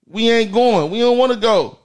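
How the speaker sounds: ordinary delivery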